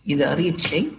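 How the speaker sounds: normal talking voice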